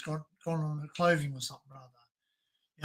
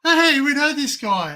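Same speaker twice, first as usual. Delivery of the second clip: excited recognition; 8 semitones higher, 8 dB louder, slower than usual. Retold or imitated. Brother spots face in mugshots.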